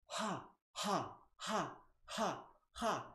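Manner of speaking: high, separate breathy "ha" exhales; laughter-practice breath, one ha at a time. Shown as a demonstration. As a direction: puff out evenly spaced high has on the breath. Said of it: "exhale is gonna sound like this".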